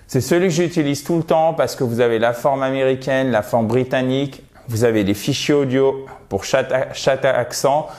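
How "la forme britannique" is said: ordinary talk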